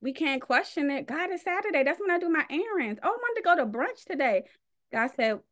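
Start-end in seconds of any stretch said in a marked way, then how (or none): 1.1-4.5 higher, whiny pleading excuse; higher mock whine, rushing the end. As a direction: play the lazy believer begging off. Mock complaint to God.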